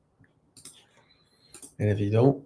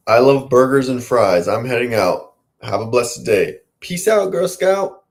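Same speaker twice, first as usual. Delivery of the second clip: loud sign-off; 3 semitones higher, 8 dB louder than usual. Acted. Sign-off to camera.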